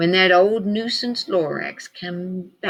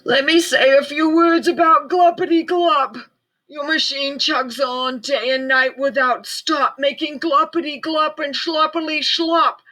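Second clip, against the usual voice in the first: snapping Lorax; 8 semitones higher than usual. A narrator's reading. Lorax scolding the Onceler over Gluppity Glup, snapping. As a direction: snap the words, dander up. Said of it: "snapped the Lorax, his dander was up".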